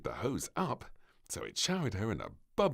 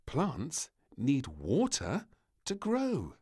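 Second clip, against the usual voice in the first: slow patient explanation; lightly voiced maid, slow; explaining maid; 6 semitones higher, 5 dB louder, slower than usual. A narrator's reading. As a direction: teach the child kindly. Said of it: "She began to explain".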